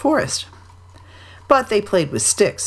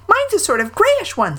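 high little timid-creature voice; Piglet. A narrator's reading. Piglet describes his stick to Pooh, high piping. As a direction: pipe it up high and small. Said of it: "said Piglet".

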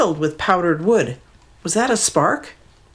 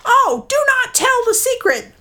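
birds pleading. Acted. Hidden birds begging the leader, high pleading call. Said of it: "called the other birds".

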